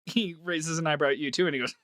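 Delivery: ordinary delivery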